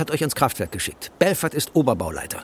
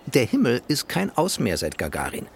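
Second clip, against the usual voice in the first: ordinary talk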